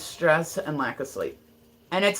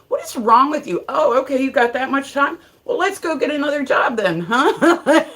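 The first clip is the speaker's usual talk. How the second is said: raised, higher exasperated parent scold; mock scolding; 8 semitones higher, 5 dB louder than usual. Mother scolding her kids for trolling, mock exasperated. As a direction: tell off the kids with sarcastic outrage.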